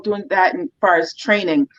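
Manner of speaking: ordinary delivery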